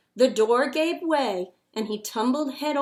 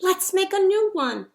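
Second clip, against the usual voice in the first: high, louder, eager call; rally to build again; eager children; 8 semitones higher, 5 dB louder than usual. A narrator's reading.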